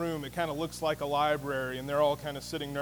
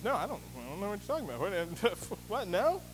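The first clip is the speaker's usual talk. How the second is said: fast, rattled denial with a nervous laugh; tripping over yourself to deny it; mock panicked suspect; flustered panic; 3 dB quieter than usual. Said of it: "'Cause Pat goes".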